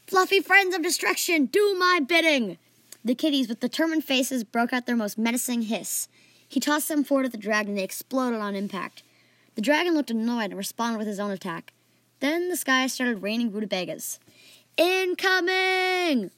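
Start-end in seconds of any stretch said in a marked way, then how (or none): 0.1-2.6 high, loud battle order; grandiose command. A narrator's reading. Fan ordering kitten bombs at the dragon, grandiose command. 14.8-16.3 high, long-drawn holler; screamed warning. A narrator's reading. Archie seeing rutabagas rain down, screaming. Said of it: "Archie screamed".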